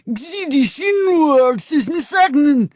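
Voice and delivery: high, slow, lips-still ventriloquist voice. Acted. Narrator does ventriloquism at shoppers, high and slow. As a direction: throw the words like a ventriloquist dummy. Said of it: "in my best ventriloquist voice".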